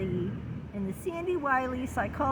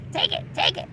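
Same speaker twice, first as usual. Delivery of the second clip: tempting id; 6 semitones higher, faster than usual. Acted. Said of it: "like the id wants to do".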